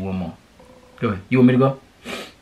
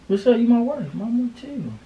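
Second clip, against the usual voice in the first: much higher, coaxing pet-name voice; calm her down sweetly; 10 semitones higher than usual. Real, not acted. Boyfriend calming his girlfriend, in high coaxing baby talk.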